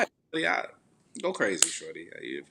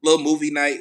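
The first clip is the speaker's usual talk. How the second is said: excited; 7 semitones higher, 10 dB louder than usual. Real, not acted. Excited about movie night.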